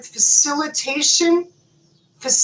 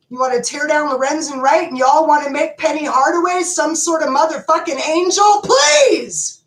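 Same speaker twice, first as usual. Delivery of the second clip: furious shout; 3 semitones higher than usual. Real, not acted. Ranting at Memphis over the Penny Hardaway praise, furious shout. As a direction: yell it out, fed up and outraged.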